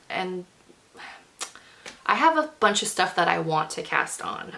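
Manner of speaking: ordinary talk, nothing marked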